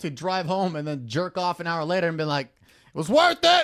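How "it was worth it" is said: high, drawn-out declaration of satisfaction; exaggerated triumphant payoff; triumphant mock cry. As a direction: stretch it out high and loud. Imagined triumphant reaction after spending.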